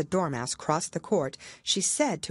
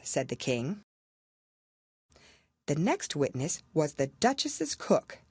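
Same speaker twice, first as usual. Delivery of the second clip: plain reading voice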